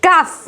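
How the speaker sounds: calf said short as kaaf; silent l. Shown as a demonstration. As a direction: say calf with no l before f. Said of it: "The right pronunciation is".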